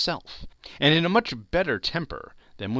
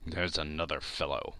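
softer, lower grumble; muttered; 7 semitones lower, 7 dB quieter than usual. Acted. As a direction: grumble it under your breath. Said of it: "muttered Scrooge".

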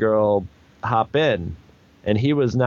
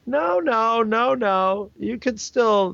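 higher, eager voice of wishful thinking; hopeful inner voice; 11 semitones higher, much slower than usual. Retold or imitated. His brain insisting it could still happen, in a higher eager voice. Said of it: "something in my brain goes".

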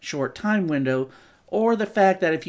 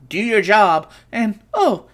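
ordinary delivery

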